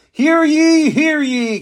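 high, loud town-crier call. Acted. Town crier calling to the villagers, loud and high. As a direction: call it out across the square. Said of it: "came a voice crying in the distance".

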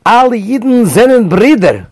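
smiling Yiddish cry to the couple; beaming declaration. Retold or imitated. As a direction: reach out with a huge smile. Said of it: "With a huge smile".

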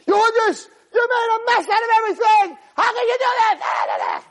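very high-pitched, loud angry scream; screaming husband. Acted. Husband screaming at his wife over a mess, screaming. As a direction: scream like an enraged husband, stretching the last words. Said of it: "When someone's screaming at his wife".

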